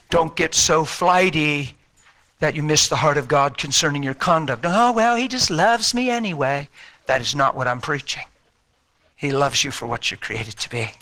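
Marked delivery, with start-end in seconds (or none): 4.7-6.7 high, airy imitation of a careless believer; mock flippant. Flighty believer excusing their conduct, mock flippant. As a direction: put on a high-pitched, breezy excuse.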